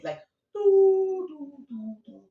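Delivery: high sustained "doo" fading away; imitated flatline. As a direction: start loud and high, let it die out. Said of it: "It's flatlining".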